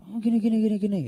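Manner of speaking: high-pitched huffy retelling; sulky. Retold or imitated. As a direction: act out an argument in a huff. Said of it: "Ya ngambek ya kan".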